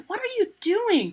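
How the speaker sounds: very high, incredulous mock question; amused disbelief. Teller replays asking the silly shape-shifting guide, amused high-pitched disbelief. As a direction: squeal it in playful bafflement. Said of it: "I was also laughing 'cause".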